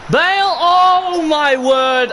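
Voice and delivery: high, loud, drawn-out cry of disbelief; real shout of disbelief; shocked outcry. Real, not acted. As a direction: yell at the screen as the shot flies off. Missed chance, live gameplay.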